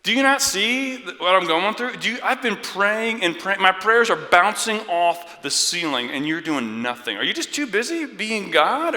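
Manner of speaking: high, loud, indignant complaint; exasperated rant. Acted. Believer demanding God notice the trouble, exasperated rant. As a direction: rail at someone who is doing nothing.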